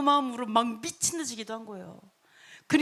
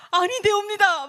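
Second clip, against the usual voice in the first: loud, high-pitched protest; alarmed refusal; 7 semitones higher, 7 dB louder than usual. Acted. Servants forbidding the king to enter the holy place, alarmed protest. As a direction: cry out to stop the king.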